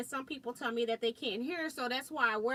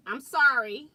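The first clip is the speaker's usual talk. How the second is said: louder, calling out sorry; loud apology; 12 dB louder than usual. Real, not acted. To a viewer who can't hear her, a loud apology. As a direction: make sure the viewer hears it.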